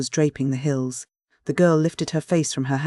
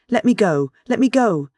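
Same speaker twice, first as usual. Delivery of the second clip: much higher, louder frantic begging; desperate plea; 7 semitones higher, 6 dB louder than usual. A narrator's reading. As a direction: beg to be let go, desperate. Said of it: "she pleaded desperately".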